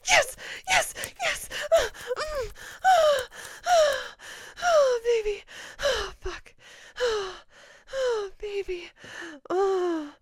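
high, loud cries slowly subsiding; climactic cries, easing. Acted. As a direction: cry out at full pitch, then begin to settle. Acted climax easing off in roleplay.

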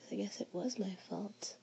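soft breathy whisper. Acted. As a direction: whisper it close to the ear.

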